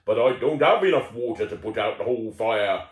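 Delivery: worried farmer pleading for help. Acted. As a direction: play the worried farmer in a country voice. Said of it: "That's my farmer voice".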